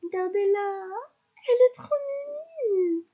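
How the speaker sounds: high, squealing delight; gushing over cuteness. Real, not acted. Streamer gushing to viewers over her Sim's cuteness, high squeal.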